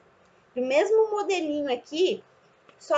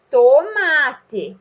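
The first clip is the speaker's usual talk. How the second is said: TOMATE louder, first syllable held; drawn-out dictation; 6 dB louder than usual. Shown as a demonstration. Teacher dictates for child to write, TOMATE drawn out. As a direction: dictate TOMATE slowly and firmly.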